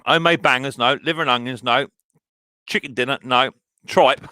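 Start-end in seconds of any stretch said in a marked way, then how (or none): none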